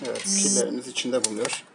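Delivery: ordinary talk, nothing marked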